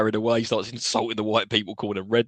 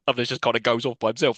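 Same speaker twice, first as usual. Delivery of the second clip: ordinary delivery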